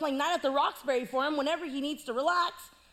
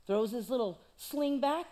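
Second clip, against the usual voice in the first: normal talking voice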